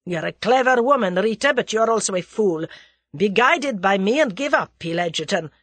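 loud, agitated Russian man; heated, urgent scolding; very excited. A narrator's reading. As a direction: press her hard, worked up. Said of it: "He was very excited".

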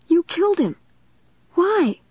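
very high, anguished outcry; horrified accusation. A narrator's reading. Narrator confronting the killer on tape, high anguished accusation. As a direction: demand an answer, pitched right up.

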